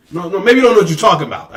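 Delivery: ordinary talk, nothing marked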